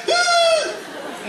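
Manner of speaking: high startled shriek; a sudden high yelp of fright; shocked scream. Retold or imitated. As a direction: shriek in horror. Fingers into empty socket.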